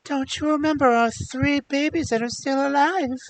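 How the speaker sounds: high pleading voice; pleading wife. Acted. Princess begging the prince to remember their babies, high and pleading.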